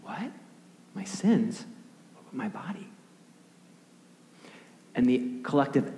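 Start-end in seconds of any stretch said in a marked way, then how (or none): 0.0-2.9 small, high, hushed questioning; soft, halting disbelief with gaps between questions; disillusioned disbelief. Acted. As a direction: ask it quietly, pitched up in bewildered letdown. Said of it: "almost disillusionment".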